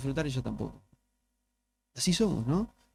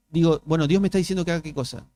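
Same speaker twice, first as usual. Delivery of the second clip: ordinary delivery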